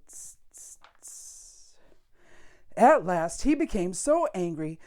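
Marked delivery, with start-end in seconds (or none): 0.1-1.8 soft unvoiced hissing tss sounds; monkey tsk. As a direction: tut back like a cheeky monkey.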